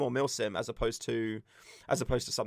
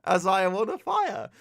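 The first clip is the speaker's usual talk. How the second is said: defiant; 5 semitones higher than usual. Retold or imitated. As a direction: brush them off with a laugh. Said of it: "I was like".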